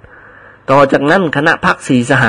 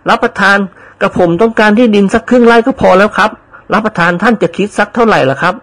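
higher, pleased servant voice; eager servant; 7 semitones higher than usual. Acted. Servant happily accepts old lady's land offer, eager higher voice.

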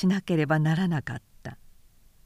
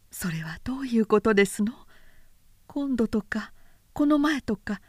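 rising, higher, trembling with suppressed anger; wife demanding an answer, voice shaking with anger; 6 semitones higher than usual. A narrator's reading. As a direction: let the anger shake the voice as it climbs. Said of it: "律の声は怒りのために震えた".

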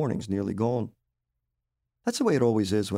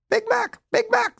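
very high squawking parrot call; 6 dB louder than usual. Acted. Crow parrots squawking at dinner time, very high. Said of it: "they called".

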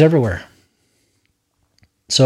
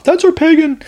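much higher mocking voice of an offended critic; mocking objector; 14 semitones higher than usual. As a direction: squeak it out like a scandalized churchgoer. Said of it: "Now I'm gonna set somebody else off".

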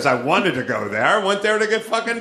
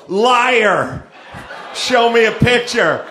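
loud, higher-pitched cry of disbelief; mock accusation; 5 semitones higher, 5 dB louder, slower than usual. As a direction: call them out as a liar, hammed up. Imagined disbelief of an excuse.

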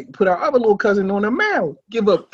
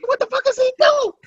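very high incredulous outcry; high mock disbelief at the dive; mock baffled uncle. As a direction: squeal it in disbelief. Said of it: "He said".

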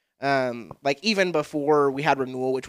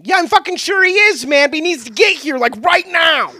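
mock exasperated son; 12 semitones higher, 9 dB louder than usual.

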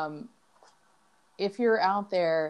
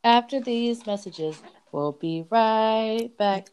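slow, drawn-out announcer voice, higher; mock TV announcer; 4 semitones higher, slower than usual. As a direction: ham up a TV break line like kids in home videos. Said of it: "we would do stuff like that".

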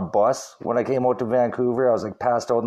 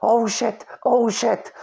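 put-on higher, rising panicked oh shit from his girlfriend; mock panic; 10 semitones higher, slower than usual. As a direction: imitate her freaking out in the car. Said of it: "she just starts going".

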